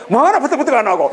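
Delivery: fast, high, laughing mock grumble; impatient Gyeongsang local. Lecturer mimicking a hot-tempered Gyeongsang person, fast and laughing. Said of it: "경상도는 뭐랄까?".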